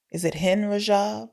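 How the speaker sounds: normal talking voice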